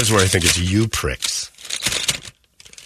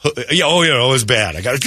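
ordinary delivery

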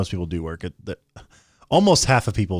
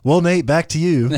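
mock news anchor; 7 semitones higher, 5 dB louder than usual. Mock anchor handoff to co-host.

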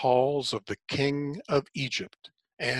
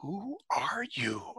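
hushed and much softer, slow and awed; 7 semitones higher, 8 dB quieter, slower than usual. A narrator's reading. Moses asking the voice in the flames, a hushed awed question. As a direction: breathe the question in awe. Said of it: "I whispered".

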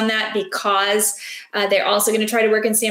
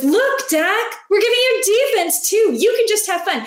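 higher, lightly voiced cosmic benefactor; voice of the universe; 9 semitones higher than usual. The universe speaks to Dak, higher and mock-benevolent. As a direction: offer the gift like a playful higher power. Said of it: "the universe opens its arms and says".

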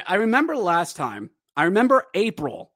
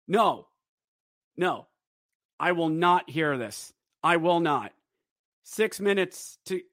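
ordinary delivery

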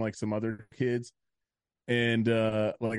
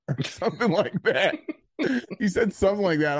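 helpless laugh breaking into the words; 7 semitones higher, 4 dB louder than usual. Real, not acted. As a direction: talk through real laughter, higher pitched. Teller cracks up at own story.

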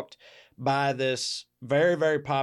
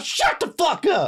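high, loud mock angry shout; imitating an aggressive shout; aggressive yell; 4 dB louder than usual. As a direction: yell it at them from a passing car. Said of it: "very aggressive".

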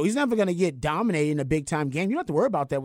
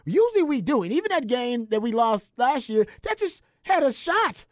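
fired up; 10 semitones higher than usual. Real, not acted. Passionate defense of Texas.

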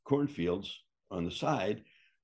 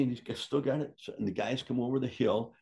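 ordinary delivery

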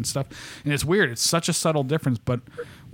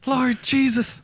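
high-pitched mock Irish moaning to Jesus; badly faked Irish accent, pleading up high; attempted Northern Irish accent; 12 semitones higher, much slower than usual. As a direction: put on a squeaky-high bad Irish accent. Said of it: "that's more Southern Irish".

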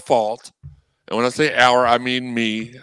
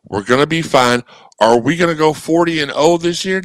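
ordinary talk

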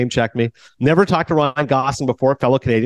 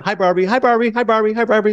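high-pitched mock doll hellos; imitated Barbie greeting; 10 semitones higher than usual. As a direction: chirp it like dolls greeting down the street, over and over. Retelling Barbies greeting each other down the street.

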